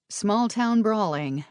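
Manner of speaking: ordinary delivery, nothing marked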